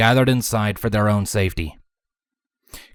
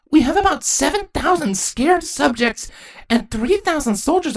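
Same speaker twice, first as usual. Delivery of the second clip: put-on very high, worried girl's voice; high-pitched anxious daughter; young princess. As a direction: fret to mother about the odds. Young princess panics to mother.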